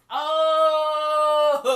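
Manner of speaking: loud, high, drawn-out gasp of revelation; shocked reaction. Retold or imitated. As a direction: hold it long and loud at the twist. Reacting to the plot twist.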